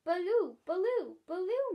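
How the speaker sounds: put-on baby babble. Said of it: "muttered Barbara".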